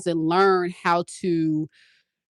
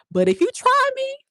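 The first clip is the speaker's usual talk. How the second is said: warning. Acted. Warning of her other side.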